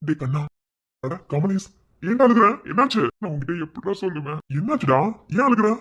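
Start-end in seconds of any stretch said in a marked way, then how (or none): 2.0-3.0 loud alarmed calling out to a crying friend; worried shout. Acted. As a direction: shout in alarm, asking what happened. Friend finds boy crying.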